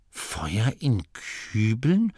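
slow, doubtful grumbled question; brummend. A narrator's reading. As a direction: drag it out into a puzzled rise. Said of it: "brummte Masklin". (skeptisch gebrummte Frage)

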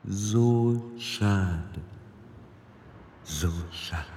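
a cold creature voice, drawn out and eerie; hissing echoing voice. A narrator's reading. The queen in Tiffany's body taunting Roland, slow soft hiss.

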